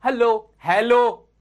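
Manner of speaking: slow, stretched hello repeated; slow demo greeting; slow drawn hello. Shown as a demonstration. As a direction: drag out each hello for learners to copy. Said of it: "मेरे जैसा बोलो".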